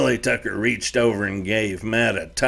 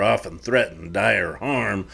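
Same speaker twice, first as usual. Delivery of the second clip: plain reading voice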